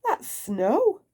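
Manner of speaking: high bright answer; Mister Silly correcting his friend; cheerful. Acted. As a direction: set him straight happily. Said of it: "cried Mister Silly".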